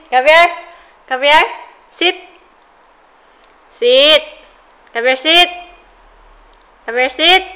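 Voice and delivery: loud dog commands. Real, not acted. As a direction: call the dog firmly and loud. Calling the dog loudly.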